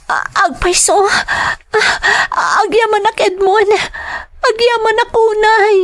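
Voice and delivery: high, breaking voice, crying with relief; tearful gratitude. Acted. Woman thanking Edmond for accepting her child, crying. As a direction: choke out thanks through tears.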